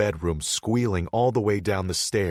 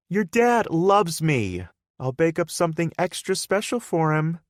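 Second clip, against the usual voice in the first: put-on girlfriend Hannah; 11 semitones higher, 7 dB louder than usual. Hannah teasing Bodhi about his dad, higher sweet voice.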